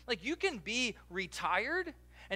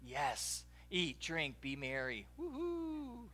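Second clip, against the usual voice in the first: mock carefree; 5 semitones lower, 9 dB quieter, much slower than usual. Mocking carefree retirement.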